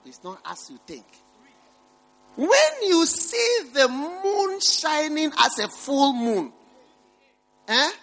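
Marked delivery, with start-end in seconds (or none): 2.4-7.9 high, slow drawn-out sermon voice; slow, lofty pulpit delivery; preaching. Acted. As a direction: paint the image slowly for the crowd.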